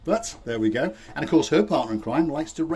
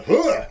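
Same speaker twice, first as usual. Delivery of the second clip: imitated huh; 8 dB louder than usual. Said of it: "I loved the".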